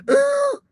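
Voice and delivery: high, loud mock groan; an exaggerated, high-pitched "ugh"; mock choked-up groan. Retold reaction to touching message.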